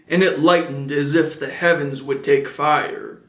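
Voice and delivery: ordinary delivery, nothing marked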